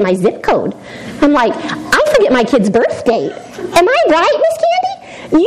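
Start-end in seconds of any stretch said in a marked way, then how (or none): 3.3-5.4 high, slow, drawn-out teasing; teasing question. Real, not acted. Teen speaker teasing Miss Candy about memory, high and slow. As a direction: playfully call her out.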